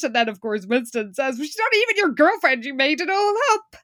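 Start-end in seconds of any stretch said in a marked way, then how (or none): 1.4-3.8 quick mock accusation. Winston quote, fast high.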